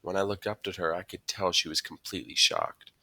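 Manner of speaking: ordinary delivery, nothing marked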